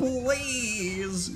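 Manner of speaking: slow high drawn out whine; mocking whine. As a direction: drag out a whiny plea.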